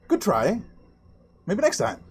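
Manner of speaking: pat the loser on the head with your voice; smug winner Rish. Acted. Rish after slamming the woman's hand, a lightly smug consolation. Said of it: "he nods and he goes".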